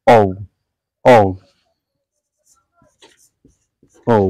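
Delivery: "ঔ" as a gliding "oh-u"; "ঔ" rounded off into u, not pure o; correct O name. Shown as a demonstration. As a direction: say "ঔ" as a diphthong ending in u. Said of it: "এটার সঠিক উচ্চারণ হচ্ছে".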